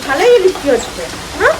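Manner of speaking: talking with a toothbrush in mouth; mumbled through brushing; while brushing teeth. Real, not acted. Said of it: "এখন আমি brush করছি".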